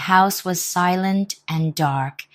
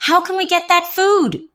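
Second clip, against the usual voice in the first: much higher, louder plaintive wail; 13 semitones higher, 6 dB louder than usual. Acted. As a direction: wail it like a hungry dog. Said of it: "wailed the dog".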